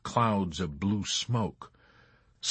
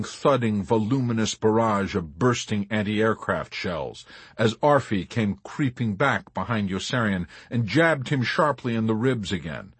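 usual speaking voice

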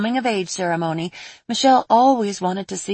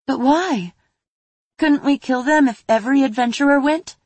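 put-on young girl voice; 7 semitones higher, 5 dB louder than usual. Child character pleading question.

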